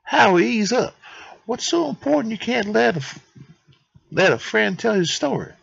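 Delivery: high, protesting mock-Italian accent; Anatoly. Anatoly chides impatient Howie, high mock-Italian accent. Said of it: "that's gonna be the accent I give him".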